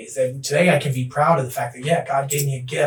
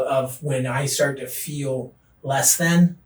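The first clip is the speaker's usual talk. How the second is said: slow emphasis; much slower than usual. Real, not acted. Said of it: "slow emphatic phrase".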